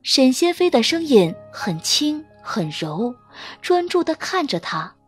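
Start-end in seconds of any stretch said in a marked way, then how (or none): none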